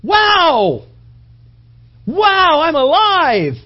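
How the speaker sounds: high, loud amazed cry; amazed husband. Retold or imitated. Husband waking in wrapping paper, loud high amazement. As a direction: act out the husband's wide-eyed wonder. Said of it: "he rips out and he goes".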